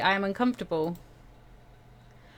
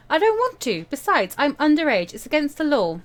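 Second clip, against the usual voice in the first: Winter refusing; 7 semitones higher, 6 dB louder than usual. Acted.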